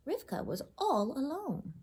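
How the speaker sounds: ordinary delivery, nothing marked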